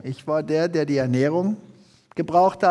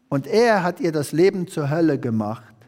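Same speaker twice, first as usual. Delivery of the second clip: ordinary delivery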